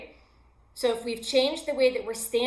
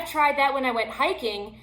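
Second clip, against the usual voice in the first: usual speaking voice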